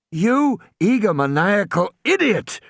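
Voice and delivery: high-pitched, indignant insult; exasperated fury, voice lifted; furious. A narrator's reading. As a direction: throw the insult out in outrage. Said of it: "Malcolm said in a fury".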